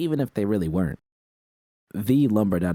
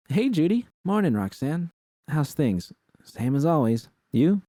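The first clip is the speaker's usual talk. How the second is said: Roxanne voiced higher; a light higher Roxanne voice; Roxanne's higher voice; 9 semitones higher than usual. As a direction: pitch up for Roxanne. Phone call with Roxanne.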